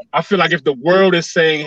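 usual speaking voice

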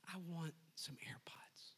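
hushed imitation of a southern wife; southern wife impression. Husband mimicking his wife's gift request, hushed southern voice. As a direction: whisper it softly in a put-on wife voice. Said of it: "she's got this southern accent".

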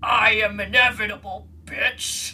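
menacing villain impression; hammed-up slasher doing a supervillain boast; Freddy as Thanos. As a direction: declare it like a gloating movie villain. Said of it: "Freddy Krueger becomes Thanos".

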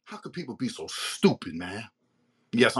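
ordinary talk

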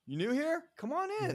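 high, bright welcoming call; cheerful greeter. Retold or imitated. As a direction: greet a lost newcomer warmly. Church greeters welcome a newcomer.

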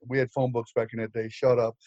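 ordinary delivery

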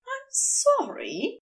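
aloof politeness. A narrator's reading. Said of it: "he said with aloof politeness".

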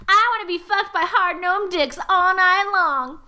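hammed-up slow wail, not a full scream; mock scream. A narrator's reading. Narrator voices the story's heroine in a mock scream. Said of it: "And she screams".

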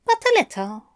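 high, light teasing angel. A narrator's reading. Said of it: "Aziraphale said".

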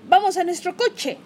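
rally them to the car; excited call. Acted. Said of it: "dijo Kenny".

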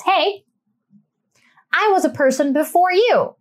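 lightly voiced Charlotte putting her foot down; a hint of Charlotte snapping at her family; mildly mock-stern Charlotte; Charlotte impression. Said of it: "Am I doing a good Charlotte impression".

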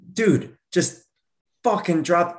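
high, exasperated snap at himself; exasperated self-scolding. Retold or imitated. Man scolding his own busy mind, exasperated. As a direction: tell yourself to drop it, fed up.

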